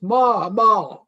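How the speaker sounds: lightly imitated calf calling its mother; a hint of a calf's drawn-out cry; newborn calf's call. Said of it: "newborn calf says".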